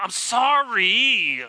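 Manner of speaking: loud, dragged-out mock sorry; a grudging, sarcastic apology, drawn out slowly; insincere sorry. Shown as a demonstration. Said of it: "Not the".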